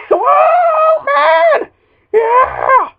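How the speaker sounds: high, loud whooping; excited shout. Real, not acted. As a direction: whoop with thrill. Wild crash celebration.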